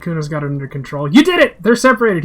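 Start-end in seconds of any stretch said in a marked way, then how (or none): 1.1-2.2 high, loud cheer; excited. Acted. Inner voice cheering the detective, high and loud. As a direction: celebrate the win out loud.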